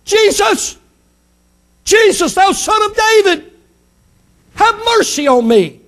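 high-pitched cry for mercy; acted shout. Blind man to Jesus in the crowd, high shout. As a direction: call out high, pleading to be noticed. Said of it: "he began to shout".

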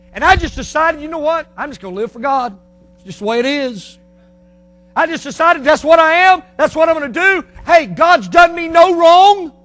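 loud, high declaration of resolve; full-force preaching. Acted. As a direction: build to a ringing pulpit shout.

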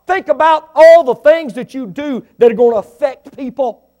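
rising to a loud, high preaching cry; preaching shout. Real, not acted. Preacher shouting a warning to the congregation, loud and high.

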